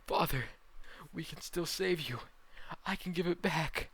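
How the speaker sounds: high, loud desperate cries; desperate scream. Acted. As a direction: shout to save a dying father. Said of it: "Tanjiro screams".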